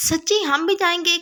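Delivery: thrilled asking to come along; happily excited. Acted. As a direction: she can't believe her luck. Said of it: "کشش خوشی سے بولی".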